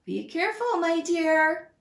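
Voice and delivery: high, caring warning; a wife warning her husband; worried mouse wife. Acted. Mouse's wife warns him, high and worried. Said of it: "cried the mouse's wife".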